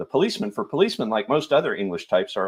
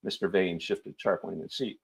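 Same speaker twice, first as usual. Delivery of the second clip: plain reading voice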